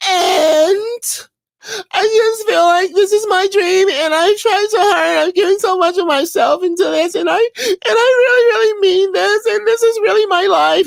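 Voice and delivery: high wailing fake sob. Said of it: "They start crying".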